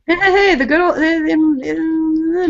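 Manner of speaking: hammed-up rambling ninety-year-old losing the thread; mock old storyteller. Co-host playing old guy telling of good old days, mock doddering. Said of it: "sound like that ninety-year-old guy".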